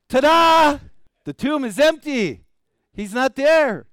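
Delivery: very loud, high, jubilant shout; exultant preaching at full voice; triumphant proclamation. Acted. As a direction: proclaim it as a grand reveal to the crowd. Preacher proclaims the girl's ta-da himself.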